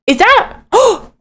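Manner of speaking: high, gasping wonder; astonished. Acted. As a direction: gasp at a precious discovery. Huck discovers kitten in pocket.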